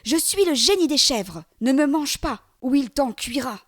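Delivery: put-on boy shouting up high to sound mighty; a loud, high, bluffing cry; a small boy's desperate bluff at full voice. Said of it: "celui-ci s'écrie".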